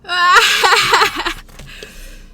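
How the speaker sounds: strained laugh through the heat; pained laugh. Real, not acted. Pained laugh during challenge.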